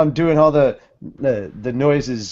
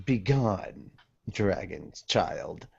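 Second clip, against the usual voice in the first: low, soft and slow contempt; cold villain dismissal; 6 semitones lower, 11 dB quieter, slower than usual. Acted. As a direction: dismiss him like an insect, quietly.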